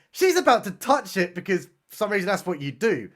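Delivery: normal talking voice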